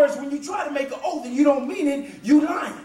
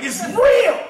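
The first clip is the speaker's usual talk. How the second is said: "real" punched high and stretched; "real" as a big intensified burst; emphatic; 5 semitones higher, 5 dB louder than usual. Shown as a demonstration. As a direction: lean hard on "real". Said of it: "simply intensifies".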